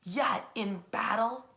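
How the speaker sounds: ordinary delivery, nothing marked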